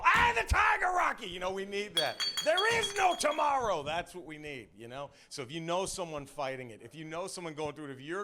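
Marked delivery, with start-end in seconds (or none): 0.0-1.3 very high, loud shout from ringside; full-throated motivational holler; cornerman's rallying yell. Acted. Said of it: "next to the mat going". 2.4-3.8 high, loud ringside shout; full-force motivational scream; cornerman's urgent yell. Acted. Acted cornerman urging fighter.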